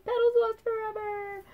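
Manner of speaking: high, sobbing make-believe wail; a child's hammed-up doll-play sob; pretend crying. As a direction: play-act a tragic little cry over a lost doll. Child playing doll lost.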